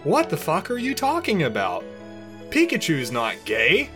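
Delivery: disbelieving protest. A narrator's reading. Ash disbelieving, quarrel in story.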